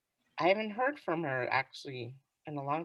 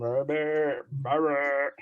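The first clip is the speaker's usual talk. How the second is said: put-on lower, crooning Mm, baby; 5 semitones lower than usual.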